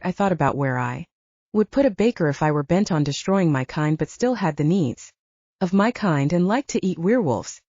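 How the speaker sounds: ordinary delivery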